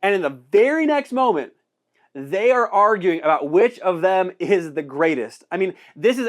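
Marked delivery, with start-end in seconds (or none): none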